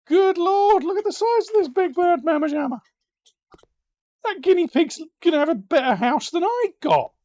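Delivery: high, loud astonished rant; loud, high amazed outburst; amazed shouting. Real, not acted. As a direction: rave about it in disbelief. Amazed at guinea pig house.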